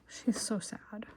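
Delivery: soft unvoiced whisper; whispered aside. A narrator's reading. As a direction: confide it softly to the listener. Narrator aside about sad sister.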